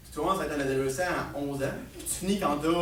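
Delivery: normal talking voice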